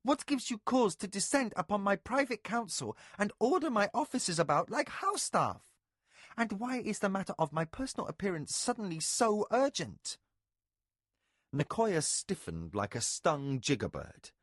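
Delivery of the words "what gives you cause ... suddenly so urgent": high, indignant questioning; lightly voiced annoyed lady; irritated. A narrator's reading. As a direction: demand an explanation. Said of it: "Mara snapped".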